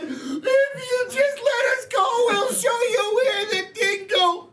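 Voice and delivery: very high little clam voice; pleading clam voice. Acted. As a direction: beg for freedom as a tiny, high-pitched creature. Talking clams plead for release.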